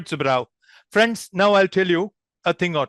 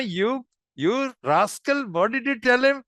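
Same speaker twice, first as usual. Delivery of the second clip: high-pitched mock accusation of a rascal; teasing; 5 semitones higher than usual. Shown as a demonstration. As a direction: playfully accuse a peer of mischief. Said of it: "finding some mischief".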